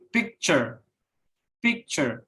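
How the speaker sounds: "picture" with the first syllable stronger, falling after; first-syllable stress. Shown as a demonstration. Student presenter showing noun stress, "picture" stressed on PIC. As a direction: stress the PIC of "picture", let the end drop. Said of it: "stress on the first syllable".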